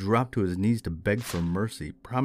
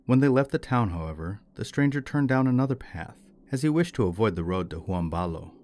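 ordinary delivery, nothing marked

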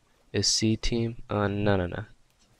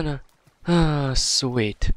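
normal talking voice

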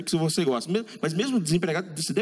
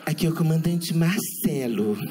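mock camp pilot. Pilot announcing the flight destination, mildly camp. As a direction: ham up a giddy pilot announcement.

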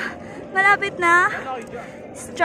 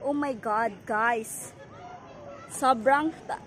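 ordinary talk

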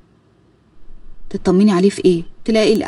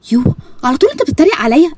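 indignant protest; 6 semitones higher than usual. Acted. Karma protesting to Ezz that he always mocks her, indignant. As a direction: push back, offended but playful. Wife protests being mocked.

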